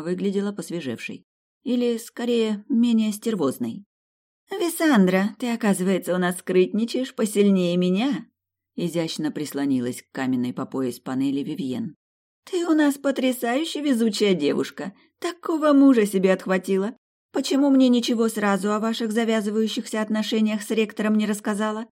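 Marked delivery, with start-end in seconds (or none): 4.5-8.3 light, higher voice for Vivien; coy teasing schoolgirl voice; sly rival girl. A narrator's reading. 12.4-21.9 light, higher voice for Vivien; coy teasing schoolgirl voice; sly rival girl. A narrator's reading.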